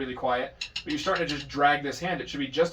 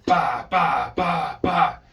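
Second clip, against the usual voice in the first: each ba punched hard and loud like an accented drum stroke; strong accented syllables; 6 dB louder, much slower than usual. Shown as a demonstration. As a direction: hit every ba as a strong accent. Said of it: "those are supposed to be strong".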